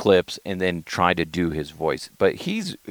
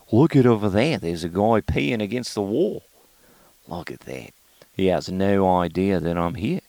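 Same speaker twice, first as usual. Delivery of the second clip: Ozzy DeHuff. Acted. Spotting a guy at the wall, comic Australian accent. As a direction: play a broad Aussie onlooker. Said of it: "Ozzy DeHuff is controversial".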